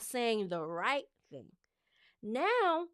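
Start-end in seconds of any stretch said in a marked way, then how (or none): 2.2-2.9 much higher and louder, animated; excited and high. Real, not acted. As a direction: burst out with delight. Excited about her confidence now.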